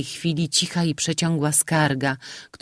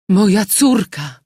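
slow, louder, higher anguished cry; shocked mother; 6 semitones higher, 8 dB louder than usual. A narrator's reading. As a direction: recognise the lost daughter. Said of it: "rzekła pani d'Aglemont".

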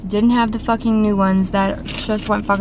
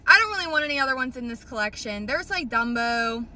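ordinary talk, nothing marked

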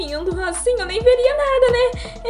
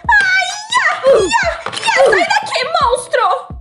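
high frightened screaming; panicked shriek; 12 semitones higher, slower than usual. Acted. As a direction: shriek at the monster in terror. Startled by a monster.

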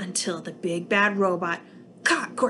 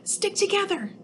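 urgent alien; 11 semitones higher than usual. Acted. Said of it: "cried Bork".

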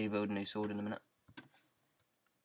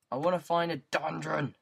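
loud, raised-pitch playful demand; mock demanding shout; 5 semitones higher, 6 dB louder than usual. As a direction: call it out like an impatient player.

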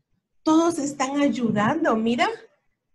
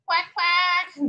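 higher quacking cuac cuac; duck quack; 6 semitones higher than usual. A narrator's reading. Narrator quacking as the duck for the child. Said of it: "dijo el pato".

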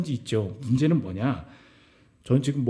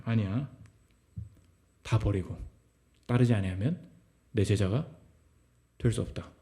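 hushed, lower, solemn command; voice of Jesus; 6 semitones lower, 7 dB quieter than usual. Acted. Preacher voicing Jesus's call to his listeners, hushed and solemn. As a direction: speak it softly as a grave call. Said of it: "예수님 뭐라 그러시냐?".

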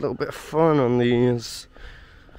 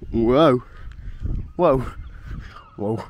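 dizzy whoas; 3 dB louder than usual. Real, not acted. Spinning on a ride.

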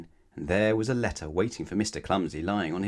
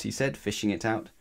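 usual speaking voice

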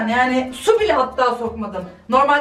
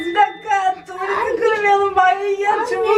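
urgent shout; 6 semitones higher than usual. Acted. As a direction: hurry them up in a panic. Woken at night, hurrying someone.

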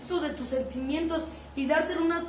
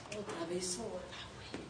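quiet despair; 7 semitones lower, 14 dB quieter than usual. Acted. Despairing low aside.

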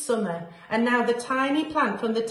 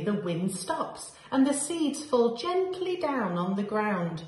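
plain reading voice

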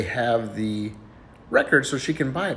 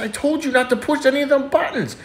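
lightly acted high-pitched telling-off; scolding flight attendant; 14 semitones higher than usual. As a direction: scold in a raised pitch, for a joke.

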